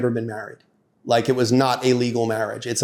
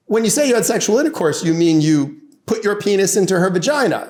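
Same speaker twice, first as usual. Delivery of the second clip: high, emphatic courtroom question; pointed cross-examination; 7 semitones higher than usual. Retold or imitated. Lawyer questioning the husband on the stand, pointed. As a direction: press the witness with mock-innocent precision.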